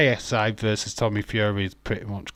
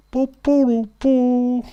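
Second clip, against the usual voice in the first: much higher and louder booing like a crowd; mock booing; 9 dB louder, slower than usual. Fan booing John Cena kicking off SmackDown, loud mock boos. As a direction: boo him like a rowdy arena fan.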